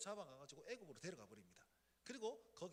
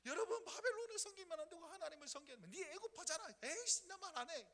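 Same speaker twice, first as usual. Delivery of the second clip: higher, impassioned crying-out prophet; a mild hint of weeping, raised voice; crying out in tears; 7 semitones higher, 4 dB louder than usual. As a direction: shout the warning through tears. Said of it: "눈물을 흘리면서 외치는 거예요".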